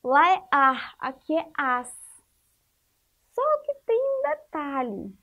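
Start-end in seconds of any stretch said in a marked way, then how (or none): 0.5-1.0 "ar" loud, ending in a breathy R; R sound contrast. Shown as a demonstration. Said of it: "O som vai ser diferente". 1.6-1.9 "as" ending in a clear hissed S; S sound contrast. Shown as a demonstration. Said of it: "O som vai ser diferente".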